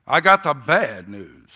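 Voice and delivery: loud, slow, ominous; preacher's booming warning. Retold or imitated. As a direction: boom it out like an old preacher. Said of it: "He said it like this".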